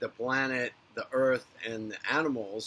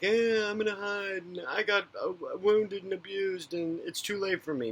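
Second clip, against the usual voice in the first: higher mock voice of a self-pitying hider; whiny excuse voice; 7 semitones higher than usual. Mocking old souls who hide away, higher whiny voice. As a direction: mimic someone making excuses to stay hidden. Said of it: "of, like".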